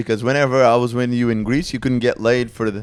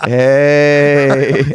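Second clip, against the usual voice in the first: dopey cow hey; 2 semitones higher, 5 dB louder than usual. Retold or imitated.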